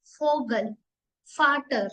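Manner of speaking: फोगल and फाटर begin with a clear F sound; German V as F. Shown as a demonstration. Teacher shows students German V as F in Vogel and Vater. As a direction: say the V as a crisp F. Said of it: "फा sound आ रही है".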